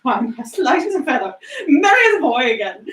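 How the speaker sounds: giddy laughter through the words, speeding up; laughing and half crying with joy. Acted. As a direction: laugh out the relief. Scrooge's joyful awakening.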